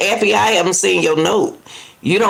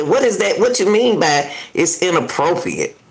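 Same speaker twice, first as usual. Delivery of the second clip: ordinary talk, nothing marked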